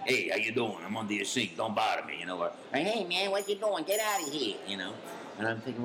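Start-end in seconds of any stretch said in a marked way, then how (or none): 0.0-2.2 a hint of a gruff Brooklyn Italian accent; lightly voiced Brooklyn plumber. Actor tries out a first Brooklyn plumber voice, a light Brooklyn accent. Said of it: "Italian plumber from Brooklyn, you know, that's like". 2.7-4.6 put-on higher, pushy Brooklyn accent; mean Brooklyn plumber. Actor sketches a rude Brooklyn plumber in a higher Brooklyn accent. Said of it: "It's kinda mean".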